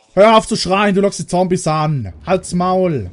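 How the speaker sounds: shouting at a screaming survivor who draws zombies; angry shout. Real, not acted. Yelling at survivor drawing zombies.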